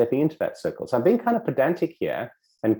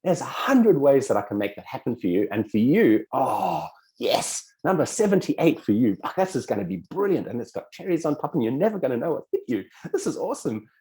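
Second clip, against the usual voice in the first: thrilled, higher-pitched acted gushing; really excited; 4 semitones higher than usual. Subconscious replying to a new choice, really excited. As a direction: gush with eager, mounting delight. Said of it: "subconscious gets really excited and goes".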